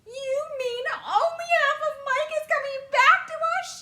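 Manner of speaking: very high, loud horrified cry; mother's horrified cry. A narrator's reading. Mother horrified at half her son returning. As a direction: cry out in horror. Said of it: "she cried".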